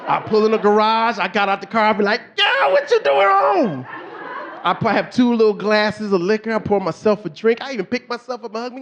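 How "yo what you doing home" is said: high, drawn-out mock delight; exuberant greeting. Husband greeting himself in the garage mirror, high excited holler. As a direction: holler it like greeting a long-lost buddy.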